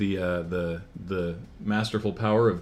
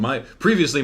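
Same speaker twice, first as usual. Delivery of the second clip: normal talking voice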